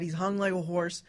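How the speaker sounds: ordinary delivery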